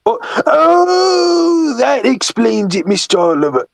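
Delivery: strained high cry of realisation, then slow and easing down; Toad. Acted. Toad realising the map is upside down, high strained cry settling slowly. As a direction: wail the oh, then draw out the explanation. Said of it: "I'm doing that voice".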